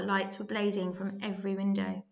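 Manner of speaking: ordinary delivery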